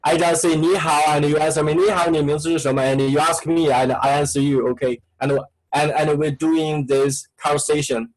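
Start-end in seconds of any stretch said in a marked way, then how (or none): none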